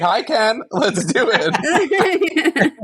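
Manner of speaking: high, slow, sing-song come-on; flirty. Pretend. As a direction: coo it like a flirt. Playful flirty quote.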